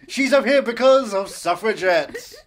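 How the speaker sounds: loud, slow mock MC; hammed-up compere milking the punchline; strip club announcer. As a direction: pitch lifted, belting out the pun. Continuing the strip club MC act.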